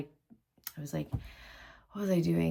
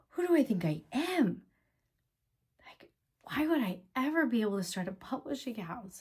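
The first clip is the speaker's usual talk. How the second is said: whispered, higher, inner self-doubt; hushed, pitched-up despair; 6 semitones higher than usual. Retold or imitated. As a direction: breathe the doubts out as if to yourself. Retold despairing thoughts after bank.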